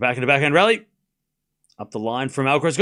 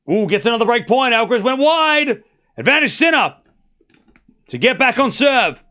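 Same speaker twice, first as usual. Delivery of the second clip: higher and louder, riding the break point; excited commentary; 9 semitones higher, 5 dB louder than usual. Real, not acted. Fan cheering Sinner's break point, excited higher call. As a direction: call it as the momentum swings.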